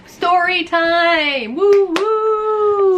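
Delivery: loud, high sing-out, long drawn vowels; hammed-up, high-pitched announcement; playful fanfare. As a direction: whoop it up like a show intro. Mock fanfare announcing story time.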